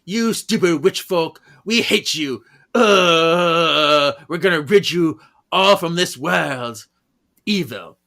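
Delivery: yelled insults. Retold or imitated. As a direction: rant at the witches. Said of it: "yelling profanities".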